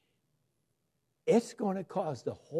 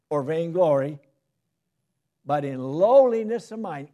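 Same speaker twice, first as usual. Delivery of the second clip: scripture reading; 4 semitones higher, 8 dB louder than usual. Acted. Preacher reading Philippians to the church, loud and rising. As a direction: proclaim the verse to the congregation.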